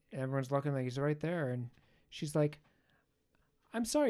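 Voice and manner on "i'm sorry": high little apology; sheepish high-voiced cashier; mock apologetic girl. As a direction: squeak out a sorry. Cashier voiced in retold story.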